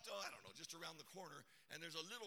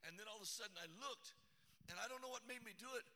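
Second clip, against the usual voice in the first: usual speaking voice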